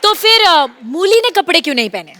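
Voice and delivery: usual speaking voice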